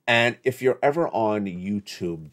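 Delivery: ordinary talk, nothing marked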